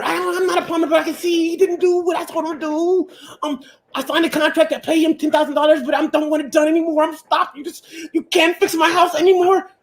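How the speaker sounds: hammed-up woman firing her contractor; mock woman arguing. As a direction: argue like a stubborn customer.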